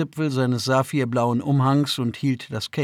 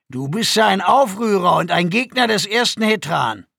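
loud, raised-pitch charge; harsh accusation; 6 semitones higher, 5 dB louder than usual. A narrator's reading. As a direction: accuse the prisoner forcefully. Guard accuses the prisoner. (laute, scharfe Anschuldigung)

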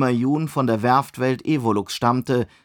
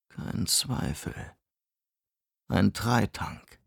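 leise, langsam, stimmlos gehaucht; für sich selbst flüsternd feststellen; geflüsterter Gedanke; 3 semitones lower, 8 dB quieter, slower than usual. Acted. Innerer Gedanke, Erkenntnis. (langsam geflüsterte Erkenntnis)

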